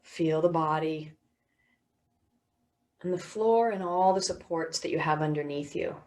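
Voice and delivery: measured body-scan voice with long pauses; guided meditation. Real, not acted. Teacher guiding listeners through body and sounds, guided meditation.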